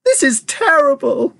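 high, drawn-out wail. A narrator's reading. Mother seeing her shrunken son, wailing. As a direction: wail it slowly in despair. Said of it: "wailed Missus Teefee".

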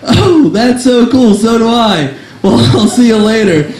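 sweet, naive goodbye; gushing woman. Retold or imitated. Woman's reply.

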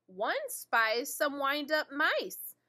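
usual speaking voice

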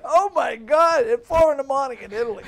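high, loud, retold disbelief; amazed exclamation. Comedian reacting to his friend's Italy story, astonished. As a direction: exclaim it loudly, pitched well up.

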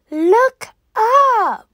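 excited call. Acted. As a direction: point everyone to the sky. Sudden bright light in sky.

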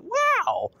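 very high, excited squeal; hammed-up wowed reaction; retold amazement. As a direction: gush in disbelief, pitch way up. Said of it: "I couldn't believe it".

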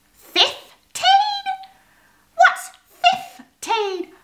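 very high, squeaky mouse chatter; a chatty mouse telling a tale. A narrator's reading. Moby Mouse's story continues.

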